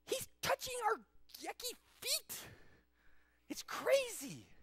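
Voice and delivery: very high, squeamish squeal; hammed-up appalled disciples; disgusted disbelief. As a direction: recoil in a high voice, stretching the last line. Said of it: "And they're going".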